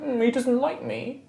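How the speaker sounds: high, pouty little voice; hammed-up high-pitched sulky whine; mock-sad pout. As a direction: say it through an exaggerated pout. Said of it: "like, pouty face".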